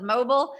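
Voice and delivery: "mobile" said Southern-style as MO-bul; Southern pronunciation. Shown as a demonstration. Host owning up to their Southern accent, Southern "mobile". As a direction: say "mobile" with a reduced second syllable, like MO-bul. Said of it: "my Southern accent calls it".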